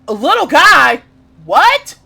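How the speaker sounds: high, loud disbelief; outraged surprise; shocked. Real, not acted. Shocked at the character.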